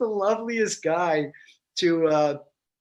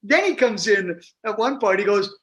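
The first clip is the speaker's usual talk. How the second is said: ordinary talk, nothing marked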